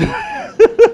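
a sudden high laugh; high burst of laughter. Real, not acted. Laughing after teasing line.

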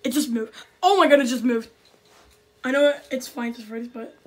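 ordinary talk, nothing marked

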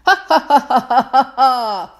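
loud hearty laugh; long laugh. A narrator's reading. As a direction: let out a big belly laugh. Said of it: "let out a long, deep laugh".

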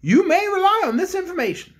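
the Bear King addresses the cook; kindly Bear King. Acted. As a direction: speak as a gentle storybook king. King speaks.

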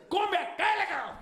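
high mock yell across the yard; calling out. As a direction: holler at your brother to come over. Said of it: "You see him in the back".

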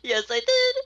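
mock triumphant. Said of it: "you're gonna be like".

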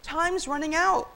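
high, urgent warning; high, anxious plea; pleading. A narrator's reading. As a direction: beg him to move in a high voice. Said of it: "Karen pleaded".

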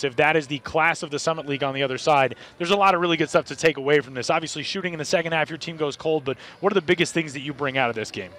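ordinary delivery

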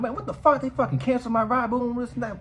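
high-pitched, agitated retelling; panicking. Retold or imitated. Retelling the ride cancellation to viewers, high-pitched panic. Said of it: "I'm starting to panic".

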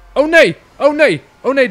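high, loud panicked yelp; startled loud oh no; panicked cry. Real, not acted. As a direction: cry out in real alarm. Train stops, panicked cry.

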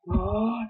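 quick surprised intake of breath; sharp gasp. Real, not acted. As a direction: gasp at the sight. Spots a bunny in game.

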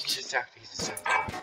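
unvoiced whisper. Real, not acted. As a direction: whisper so he won't hear. Sneaking past the diner owner.

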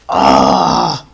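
very low, rough groan; frustrated groan. Real, not acted. Player mad after dying at home, low groan. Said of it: "that made me mad".

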